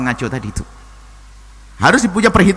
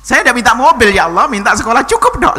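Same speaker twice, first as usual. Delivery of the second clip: higher, louder aggrieved complaint, imitated; mock angry prayer; 8 semitones higher, 5 dB louder than usual. Preacher acts out a parent grumbling at God over school fees, higher mock angry complaint. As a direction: protest to God like a wronged petitioner. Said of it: "Lo kok marah dengan Allah?".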